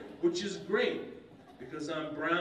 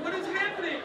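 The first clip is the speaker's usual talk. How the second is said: high-pitched bewildered cry; baffled outburst; 12 semitones higher, 4 dB louder than usual. Acted. Comic baffled by cousin's baby uncle, high disbelieving cry.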